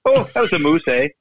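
put-on light Canadian accent with a drawn "eh?"; Canadian-accented understatement; mock Canadian accent. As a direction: imitate the Canadian guy confessing a ten. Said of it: "I guess that's a Canadian way of saying".

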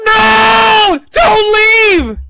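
high, loud pleading yell; mock crowd shouting don't leave; crowd scream. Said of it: "You can hear the crowd screaming".

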